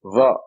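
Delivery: Va said with the tongue stuck out; heavy Z with the tongue between the teeth; tongue sticking out. Shown as a demonstration. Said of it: "you have to stick your tongue out".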